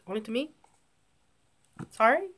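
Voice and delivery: ordinary talk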